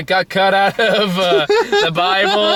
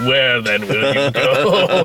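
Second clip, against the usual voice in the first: slow, portentous villain question; ominous villain; 3 semitones lower, much slower than usual. Retold or imitated. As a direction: draw it out like a film villain. Retelling a villain's ominous line.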